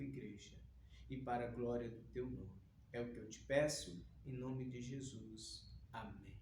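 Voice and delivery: low, soft prayer to God; hushed, low-pitched congregational prayer. Real, not acted. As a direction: pray quietly and reverently, voice kept low. Leads an opening prayer.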